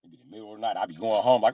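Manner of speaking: ordinary talk, nothing marked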